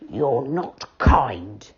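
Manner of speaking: very slow, low hurt teddy voice; a sulky teddy reproaching; Moonface, slow and hurt. A narrator's reading.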